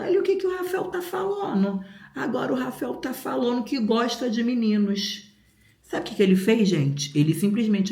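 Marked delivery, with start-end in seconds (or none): 0.0-5.3 high-pitched imitation of an appalled mom; horrified mother. Horrified mother reporting son to stepdad, high-pitched mock outrage. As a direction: act out mom telling on her son in a high voice. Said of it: "minha mãe ficou horrorizada".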